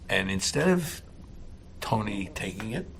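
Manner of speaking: usual speaking voice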